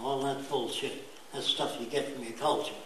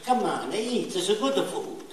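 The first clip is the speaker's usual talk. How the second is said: mock Italian host, higher and laughing; Italian accent; 5 semitones higher than usual. Italian-raised man offering food, in a mock Italian accent. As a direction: urge the guest to eat, Italian style. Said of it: "even the Italian accent is picked up".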